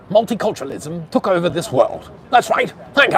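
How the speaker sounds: imitated old-time broadcaster, rising to a high shout; fifties radio announcer. Mocking old radio shows for livestream viewers, vintage announcer voice. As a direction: mimic a vintage radio host, building to a loud sign-off. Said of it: "that's about how they sounded".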